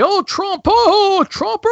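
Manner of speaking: high mocking fanboy swoon; Richard Spencer. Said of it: "You got Richard Spencer tweeting out".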